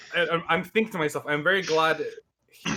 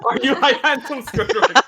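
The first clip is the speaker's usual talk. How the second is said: teasing; 9 semitones higher, 7 dB louder than usual. Pretend. Teasing the giant.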